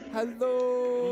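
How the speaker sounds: loud, high cheerful hello; excited greeting. Real, not acted. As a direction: call out hello to the screen. Greeting viewers on screen.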